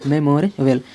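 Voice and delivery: ordinary talk, nothing marked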